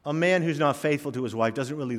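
ordinary talk

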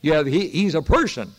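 impassioned sermon delivery; fervent preaching. Acted. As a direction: preach it out to the congregation. Returns to preaching points.

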